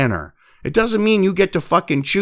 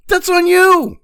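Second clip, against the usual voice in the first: "that's on you" pitched much higher and louder, falling on "you"; exasperated higher repeat; 12 semitones higher, 5 dB louder, slower than usual. Real, not acted. Repeating the blame to viewers, higher and louder. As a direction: throw it up high in exasperation.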